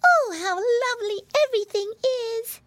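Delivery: put-on high, delighted little girl; squeaky piglet wonder; Baby Pinky. A narrator's reading. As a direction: gush in a tiny child's voice. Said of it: "cried Baby Pinky".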